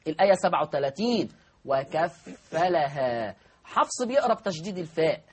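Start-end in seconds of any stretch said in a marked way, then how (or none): 1.7-3.4 "وكفلها" mit einfachem Fa, sehr langsam; "وكفلها" leicht, Fa nicht betont; Fa ohne Verdopplung. Shown as a demonstration. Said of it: "بتخفيف الفاء".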